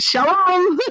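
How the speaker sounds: high, sing-out mock cheerful greeting; peppy. Puerto Rican teacher greeting class every day, imitated peppy. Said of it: "It just sounds so peppy".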